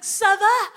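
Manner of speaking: high, loud chirpy Ça va?; French greeting. Retold or imitated. Tourist greeting Swiss in French, chirpy. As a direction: try out Ça va? brightly. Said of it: "I was like".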